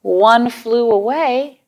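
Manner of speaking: slow, sing-song nursery rhyme; counting rhyme. Acted. Teacher recites a counting rhyme to children, slow and sing-song. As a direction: chant it slowly for little ones.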